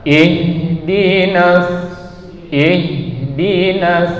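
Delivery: slow, chanted "Ihdinas" with a full, audible chesty H; drawn-out, resonant H. Shown as a demonstration. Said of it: "di sini kita baca huruf H". ("Ihdinas" dilantunkan panjang dengan H bergema di dada)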